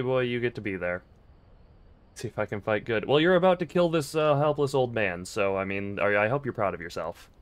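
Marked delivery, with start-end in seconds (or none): 3.0-7.3 mock outrage. Mock guilt-trip at card Wolfie.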